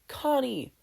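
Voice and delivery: high, startled cry of a name; calling out. Acted. Steven spotting his friend, calling out high. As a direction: call her name in sudden relief.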